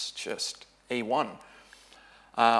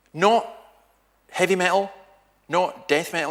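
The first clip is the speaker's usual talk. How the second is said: incredulous question; 6 semitones higher, 6 dB louder than usual. Retold or imitated.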